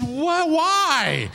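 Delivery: high, drawn-up disbelieving demand; incredulous questioning. Retold or imitated. Husband to wife holding bitten baby, incredulous.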